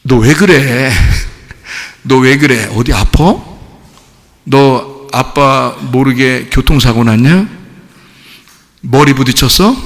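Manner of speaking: lightly voiced parent checking on an odd child; puzzled parent. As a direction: imitate a parent, bemused and deliberate. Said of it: "어머니가 과연 어떻게 생각할까?".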